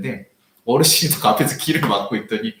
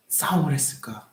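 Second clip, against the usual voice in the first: hushed self-questioning; whispered regret; 5 dB quieter than usual. Real, not acted. Pastor asking himself why he fought, whispered. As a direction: ask yourself softly.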